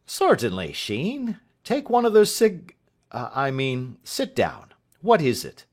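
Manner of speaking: a hint of a flustered genial master; schoolmaster. A narrator's reading. Master Spence voiced by reader.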